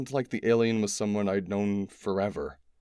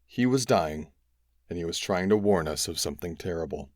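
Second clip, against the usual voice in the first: ordinary delivery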